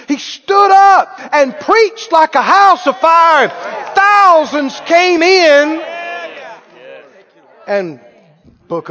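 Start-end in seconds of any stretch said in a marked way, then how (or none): 0.1-5.8 loud, high, slow preacher's cry; full-force preaching. Real, not acted. Preacher telling of Pentecost to congregation, preaching at full force. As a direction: proclaim it loudly, stretching the big words.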